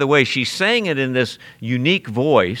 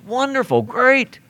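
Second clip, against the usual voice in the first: high, sarcastic wonderful; a touch of sarcasm; mock praise; 11 semitones higher than usual. Preacher mocking the girl's praise, high and sarcastic.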